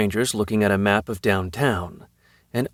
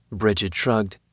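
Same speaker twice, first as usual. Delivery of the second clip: usual speaking voice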